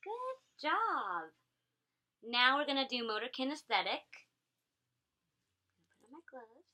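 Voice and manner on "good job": praise. Real, not acted. Praising child after success.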